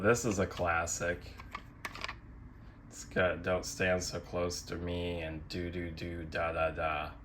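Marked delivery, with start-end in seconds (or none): none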